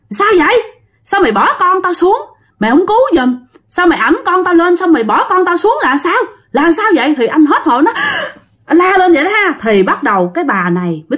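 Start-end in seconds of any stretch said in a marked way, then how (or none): 0.1-7.1 higher, scolding ghost voice; scolding ghost woman. Retold or imitated. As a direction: scold him as the ghost mother. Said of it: "là chửi anh".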